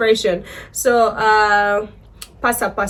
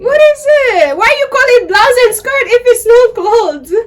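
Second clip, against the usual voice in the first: excited; 11 semitones higher, 6 dB louder than usual. Real, not acted. Baffled loud questioning.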